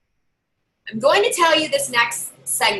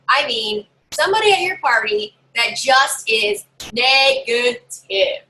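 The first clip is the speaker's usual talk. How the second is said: normal talking voice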